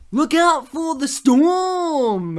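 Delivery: loud, high, slow drawn-out warning cry; weirdly shouted warning. Retold or imitated. Film character running up to warn about the storm, weird slow holler. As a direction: holler it slowly, stretching the words out. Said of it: "said it in the weirdest manner".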